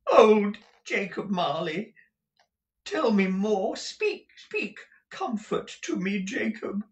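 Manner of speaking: high, hushed pleading for comfort; imploring. Acted.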